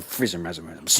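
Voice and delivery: imitating Muttley's cartoon dog snicker. Speaker sulking back to the car, doing a Muttley snicker. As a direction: snicker through the nonsense words like Muttley. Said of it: "Remember Muttley, the cartoon Muttley?".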